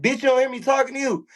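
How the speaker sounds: mock outrage, voice shooting way up; indignant call-out. Inmate to officer who won't answer him, indignant. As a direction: retell it as a high-pitched, indignant challenge.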